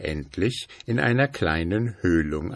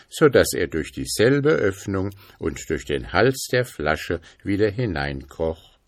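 ordinary delivery

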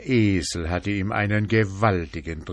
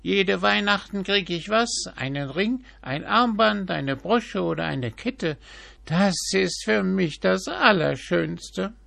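very high, greedy magpie; 12 semitones higher, 4 dB louder than usual. A narrator's reading. Magpie voiced.

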